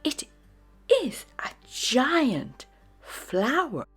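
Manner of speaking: usual speaking voice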